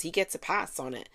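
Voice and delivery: ordinary talk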